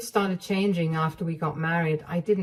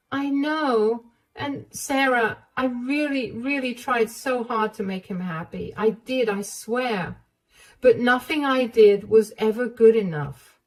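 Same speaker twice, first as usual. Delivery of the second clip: higher, earnest pleading; desperate young wife; 6 semitones higher than usual. Acted. Emma swearing to Sarah she tried to please David, higher and earnest. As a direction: insist you tried hard.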